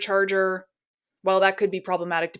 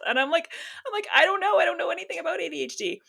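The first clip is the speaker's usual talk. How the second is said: real laughter through the words, pitched higher; laughing; 10 semitones higher, 3 dB quieter, faster than usual. Real, not acted. Telling how she answered husband, laughing. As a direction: giggle it out, higher and fast. Laughing retelling her confusion.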